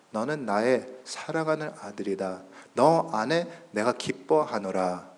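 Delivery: soft, low, reverent voice; tender fatherly words. Acted. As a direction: speak gently as a loving Father. Voices God the Father's loving words. (낮고 부드러운 아버지의 음성)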